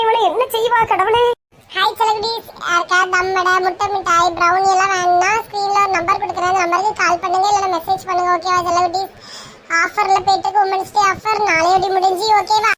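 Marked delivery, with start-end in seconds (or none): 1.7-12.7 chirpy sign-off to the viewers; vlog outro. Real, not acted. Host addressing her viewers at the end, chirpy. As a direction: wrap up like a friendly YouTuber.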